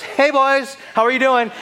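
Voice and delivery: high, loud, casual hail; breezy greeting. Acted. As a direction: call out to pals in passing, high and loud. Jesus casually greets rowers while passing.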